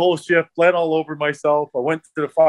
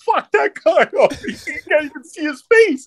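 high, laughing retelling of a teammate; 13 semitones higher, 3 dB louder than usual. Retold or imitated. Teammate marvels at the beaten guy, laughing. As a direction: crack up as the amazed teammate. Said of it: "He goes".

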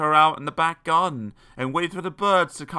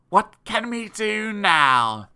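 put-on slow, higher smirking boy; drawn-out scheming child; 9 semitones higher, 5 dB louder, slower than usual. A narrator's reading. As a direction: drawl it slyly with a smirk. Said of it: "he smirked".

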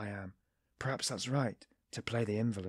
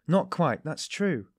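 eager; 5 semitones higher, 7 dB louder, slower than usual. A narrator's reading. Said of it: "agreed at once".

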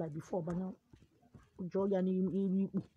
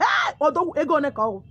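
high, loud shout of Hey, Odogwu; excited shout; 6 semitones higher, 8 dB louder than usual. Acted. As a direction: shout it out in surprise. Client shouts at the doctor.

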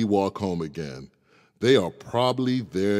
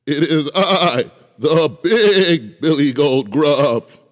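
big goat loud; 8 semitones higher, 10 dB louder than usual. Acted. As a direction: boom like the biggest goat. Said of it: "his voice was as loud as the troll's".

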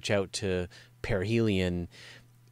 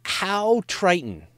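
mock childlike question; 11 semitones higher, 6 dB louder, much slower than usual. Host asks playful silly question.

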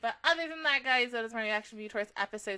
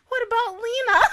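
tearful plea; 12 semitones higher, 11 dB louder than usual. Real, not acted. Upset the character is missing.

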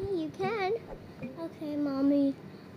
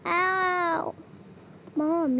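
ordinary talk, nothing marked

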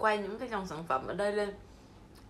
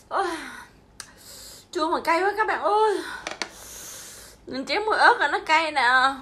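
loud, high sour-and-spicy yelp; eating sour candy; 6 semitones higher, 7 dB louder than usual. Real, not acted. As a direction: cry out at the sour, spicy bite. Sour spicy bite, loud reaction.

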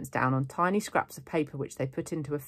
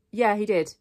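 louder, higher contradiction; insistent; 6 semitones higher, 6 dB louder than usual. A narrator's reading. Boy contradicting his friend in a quarrel, insistent. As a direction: argue back firmly.

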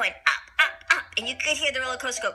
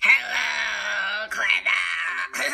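goblin voice; 4 semitones lower, slower than usual. Acted. Goblin threatening Clever Trevor, low mock menace. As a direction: lower growly goblin threat, lightly voiced. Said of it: "he said".

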